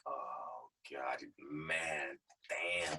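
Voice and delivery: very low, quiet inner groan of dread; hushed dread. Retold or imitated. Employee singled out by the boss, hushed dread. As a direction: think it under your breath, sinking.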